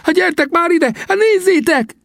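near-shriek, very high and excited; excited high-pitched yell; almost screaming Sam. A narrator's reading. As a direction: call friends over, almost screaming. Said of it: "mondta Sam szinte sikoltva".

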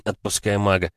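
ordinary delivery, nothing marked

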